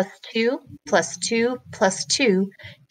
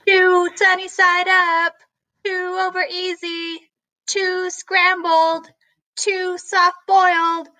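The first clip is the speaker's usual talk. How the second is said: cook calling orders; 9 semitones higher than usual. Acted. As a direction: sing out every order to the kitchen. Cook calls the orders back.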